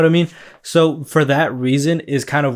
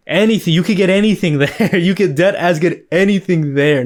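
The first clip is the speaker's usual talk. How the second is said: laughing; 4 semitones higher than usual. Real, not acted. Laughing about the shop menu.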